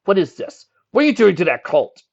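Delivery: angry demand. Acted. Shining Armor confronting Nick about the colt, angry demand. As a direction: demand it in anger. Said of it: "felt his anger swell".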